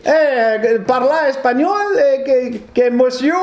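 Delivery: lightly mocked fake Spanish accent; a hint of a put-on Spanish lilt. Mimicking a friend faking Spanish, light mock accent. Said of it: "qui parle en espagnol".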